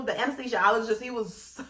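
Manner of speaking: ordinary talk, nothing marked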